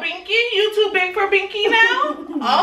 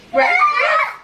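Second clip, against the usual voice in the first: Breakfast? squealed way up high and louder; excited high question; 12 semitones higher, 3 dB louder than usual. Real, not acted. Mom squeals to her toddler about breakfast, excited high question. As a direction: squeal it like a treat.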